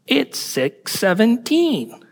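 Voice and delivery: high, slow British phone assistant. Acted. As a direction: draw it out in a British voice. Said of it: "in a British voice".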